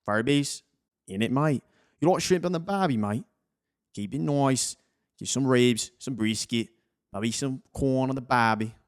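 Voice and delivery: mock Aussie accent, lilting and chummy; playful Australian accent. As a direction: put on a jokey Australian accent. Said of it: "that's my little Australian accent".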